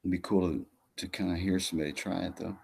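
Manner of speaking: normal talking voice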